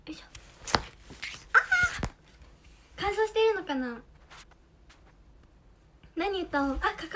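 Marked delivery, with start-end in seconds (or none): 1.5-2.1 vocal warm-up yell. Real, not acted. Testing her voice before singing.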